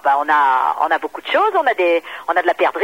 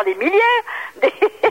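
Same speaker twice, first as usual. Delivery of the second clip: laughing; 11 semitones higher, much slower than usual. Real, not acted. Giggling through the answer.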